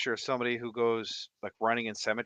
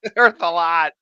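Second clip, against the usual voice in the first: laughing imitation; 5 semitones higher, 7 dB louder than usual. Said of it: "My grandpa was saying, screaming that".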